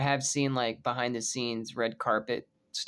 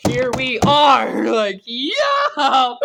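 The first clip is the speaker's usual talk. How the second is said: slow, much higher and louder triumphant yell with a laugh; triumphant shout; 11 semitones higher, 12 dB louder, much slower than usual. Real, not acted. Fan gloating at doubters about the crossover, triumphant shout. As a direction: crow it like you were proven right.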